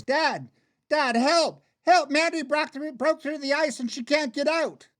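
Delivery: high, panicked calling; frantic cry for help. Acted. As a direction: blurt out the emergency to a parent. Boy screams for father's help.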